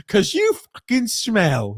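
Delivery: mock blunt insult. Shown as a demonstration. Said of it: "I wouldn't say it like that".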